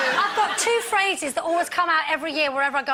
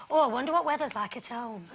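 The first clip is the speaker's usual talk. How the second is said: low, softly musing voice; idle wondering; 5 semitones lower, 6 dB quieter than usual. Retold or imitated. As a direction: muse softly, imitating a holidaymaker. Voicing the holidaymaker's idle wondering.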